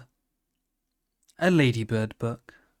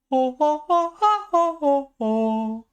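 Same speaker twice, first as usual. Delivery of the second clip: high-pitched jolly ho ho ho, much higher than his own voice; character laugh; 5 dB louder, slower than usual. Acted. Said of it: "I'll go back into character".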